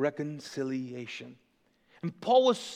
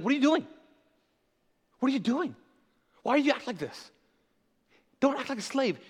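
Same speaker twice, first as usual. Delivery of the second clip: higher-pitched, incredulous scolding; imitating a baffled apostle confronting people; exasperated rebuke; 6 semitones higher, faster than usual. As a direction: plead in acted disbelief, voice pitched up. Imagines Paul scolding people here.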